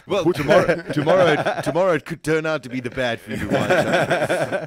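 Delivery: laughing. Real, not acted. Host jokes while laughing.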